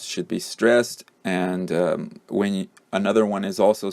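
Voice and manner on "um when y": normal talking voice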